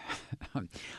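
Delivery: soft, breath-only chuckle; quiet laugh under the breath; stifled little laugh. Real, not acted. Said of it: "I'm laughing because".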